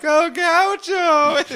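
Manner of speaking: very high, slow swoon; swooning fans. Retold or imitated. Soap girls fawning over hero, high swoon. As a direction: sigh the name like a lovesick fan. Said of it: "they're like".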